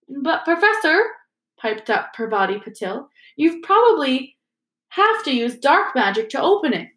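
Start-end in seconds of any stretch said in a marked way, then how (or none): 0.1-1.0 put-on high, piping girl voice; schoolgirl voice. Parvati pipes up. 3.4-6.9 put-on high, piping girl voice; schoolgirl voice. Parvati pipes up.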